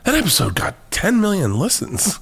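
high, awed mock Joe; imitating Joe. Joe telling him the episode numbers, high mock awe. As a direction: lift it way up in wide-eyed wonder. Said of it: "but he'd be like".

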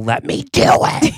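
a hammed-up shout, half breathy; mock yell. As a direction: bark it out as a joke. Improv character yelling.

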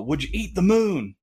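high, swooping sportscaster impression; silly impression, pitch swung up high; Will Ferrell as Harry Carey. Host redoes a listener's question for fun, comic impression. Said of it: "I could do the impression of Will Ferrell".

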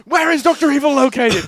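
loud high mock interrogation; mock interrogation shout. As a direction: demand it like a tough interrogator. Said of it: "interrogation bit".

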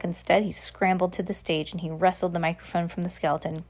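usual speaking voice

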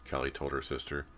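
ordinary delivery

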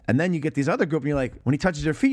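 normal talking voice

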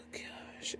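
heavy sigh, then a barely audible whisper; weary whisper. Real, not acted. Host exhausted by the movie synopsis, weary whisper. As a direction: sigh it out under your breath.

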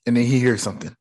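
normal talking voice

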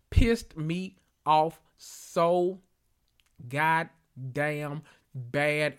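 slow, stretched, fed up; drawn-out exasperation. Real, not acted. Still mad about the blueberry lie, slow exasperated drawl. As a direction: drag each word out in disbelief. Dragging out her anger.

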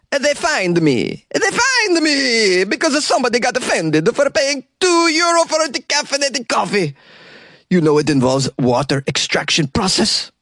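indignant Italian barista whining, high up; exaggerated Italian owner. Host voices the fined coffee bar owner, high, hammed-up indignation. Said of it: "Here's a quote from the owner".

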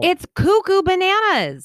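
high, loud burst of amazement; amazed exclamation. Real, not acted. Reacting to co-host losing twelve pounds, amazed. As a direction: pitch it way up and exclaim.

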